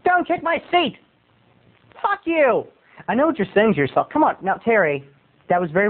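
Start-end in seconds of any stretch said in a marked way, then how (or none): 0.1-2.6 high-pitched squawky mock outburst; angry moviegoer. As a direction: act an irate woman in a high voice. Imitates angry woman at cinema.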